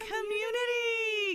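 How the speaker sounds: drawn out high and slow, singsong; mock correction. Teasing chat by swapping cult for community, singsong. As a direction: stretch it out sweetly to cover the slip.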